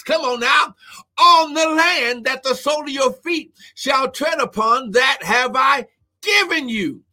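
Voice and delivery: ringing full-force scripture proclamation; scripture preaching. Real, not acted. Preacher proclaiming God's command to cross the Jordan, scripture preaching. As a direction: proclaim the verse, lifting key words high.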